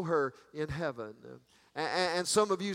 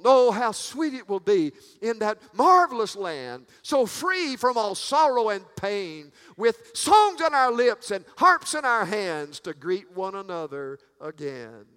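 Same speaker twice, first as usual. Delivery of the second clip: soaring preaching; 4 semitones higher, 6 dB louder than usual. Real, not acted. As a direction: let it ring out in rapture over the congregation.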